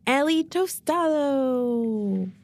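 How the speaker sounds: slow, melodic "Ellie Tostado" with a good accent; careful name pronunciation. Real, not acted. Guest introducing herself on a show, careful name pronunciation. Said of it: "pronounce that in a good accent".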